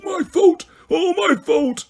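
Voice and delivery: put-on high, broken sobbing old man. A narrator's reading. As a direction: sob out the guilt. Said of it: "he sobbed".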